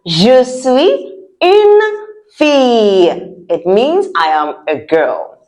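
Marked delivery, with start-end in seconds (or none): 0.1-3.4 slow, spaced demo with a higher middle; each word held for learners to repeat; slow model pronunciation. Shown as a demonstration. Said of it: "Say that again".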